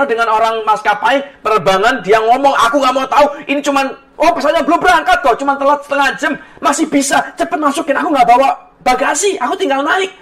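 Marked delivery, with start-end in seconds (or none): 2.7-10.1 higher, insistent mock complaint; retold angry protest. Late passenger argues with airline staff, retold angry protest. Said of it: "Dia marah dengan orang bandara".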